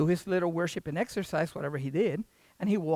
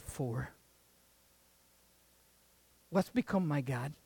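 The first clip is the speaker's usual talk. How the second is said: solemn preaching; 5 dB quieter than usual. Real, not acted. Preacher asking the congregation to look inward, solemn. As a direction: bring it down to a grave, personal tone. Hushed self-examination before congregation.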